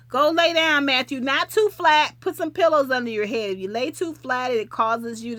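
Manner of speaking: loud motherly nagging; loud caring advice. Real, not acted. Caring advice to sick viewer.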